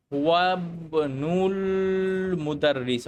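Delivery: হুয়াবনুল drawn out as one word into মুদাররিস; linked reading. Shown as a demonstration. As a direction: stretch the b and l of হুয়াবনুল, both hamzas skipped. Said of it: "তখন আমরা এইভাবে পড়ি".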